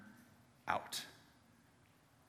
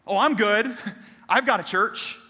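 louder, higher smug brush-off; mock a comfortable churchgoer; mock complacency; 5 semitones higher, 4 dB louder than usual.

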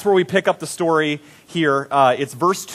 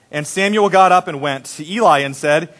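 ordinary talk

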